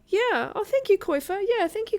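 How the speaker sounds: delighted squeal. Real, not acted. Delighted thanks for donation.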